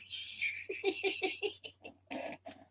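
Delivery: high breathy giggle; squeaky nervous laugh. Real, not acted. Laughing at creepy doll.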